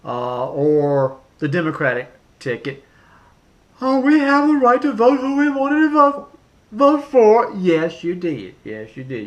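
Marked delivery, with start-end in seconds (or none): 3.8-7.5 high, loud, whiny mock voice; mocking Democratic voters. Ranting vlogger mocks voters who opposed Trump, high whiny voice. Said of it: "you'll probably be saying". 8.6-9.3 muttered retort. Acted. Vlogger repeats his retort to voters lower and softer.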